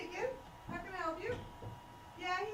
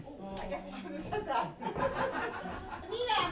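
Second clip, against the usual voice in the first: normal talking voice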